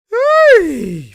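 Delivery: high, loud "hey"; excited whoop. Real, not acted. Analyst reacting to Luka's floater, excited whoop. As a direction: shout it as the shot drops. Cheering a highlight play.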